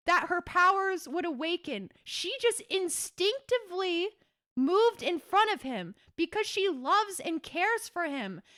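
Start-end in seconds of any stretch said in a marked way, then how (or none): none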